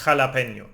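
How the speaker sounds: jalapeño with a throaty Spanish j, h sound from the throat; jalapeño as ha-la-PEH-nyo; Spanish jota. Shown as a demonstration. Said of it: "You are scraping the throat".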